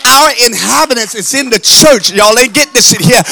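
loud, urgent preaching building to a high shout; full-force preaching. Real, not acted. Preacher rebuking a distracted church, full-force preaching rising to a shout. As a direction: push harder until the last words are long, loud cries.

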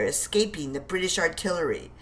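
ordinary delivery, nothing marked

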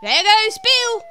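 high, loud chorus, drawn out; crowd shout. Acted. The animals shouting together as Bill shoots up the chimney, high and loud. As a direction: cheer as Bill flies out. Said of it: "a general chorus of".

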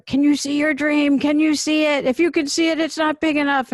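lightly voiced motivational teacher urging the class; imitating Angela. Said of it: "She did one of her Angela, like, things".

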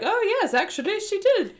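high, loud put-on British accent; mock British. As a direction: play it as a hammed-up British reply. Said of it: "I don't know why they're British".

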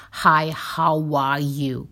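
slowly drawn-out mock greeting; slow, insincere how-are-you; fake passing greeting. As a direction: stretch out the greeting as if walking on past. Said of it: "everybody's just walking and asking you".

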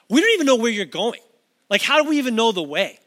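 exasperated, rapid-fire questioning; baffled protest. Acted. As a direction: blurt it out as a bewildered disciple. Acts out baffled Thomas questioning Jesus.

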